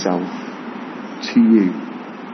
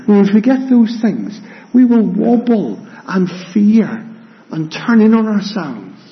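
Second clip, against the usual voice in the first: preaching; 8 semitones higher than usual. Acted. Preaching the sermon.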